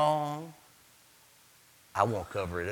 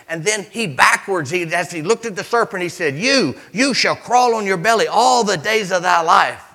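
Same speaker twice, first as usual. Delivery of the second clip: loud, high preaching cadence; fiery pulpit preaching; preaching at full force; 4 semitones higher, 8 dB louder than usual. Acted. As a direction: drive the story home to the congregation. Preaching the garden story.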